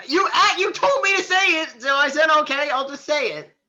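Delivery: high, loud protest; indignant self-defense. Real, not acted. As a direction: protest loudly that it was their idea. Defends himself after being blamed.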